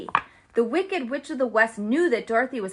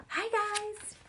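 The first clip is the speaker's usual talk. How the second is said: witch voice; 10 semitones higher, 8 dB quieter than usual. Acted. Greeting in a witch voice.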